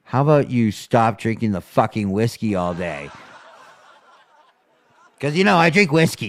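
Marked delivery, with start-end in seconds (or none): 5.2-6.3 mock mother-in-law. Mother-in-law voiced mockingly.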